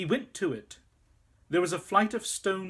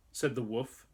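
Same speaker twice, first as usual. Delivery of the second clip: plain reading voice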